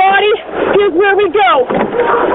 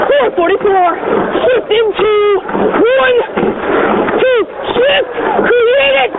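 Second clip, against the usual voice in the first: sharp counted stroke calls, higher; rowing coxswain calls; 6 semitones higher, slower than usual. Acted. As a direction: drive the crew stroke by stroke.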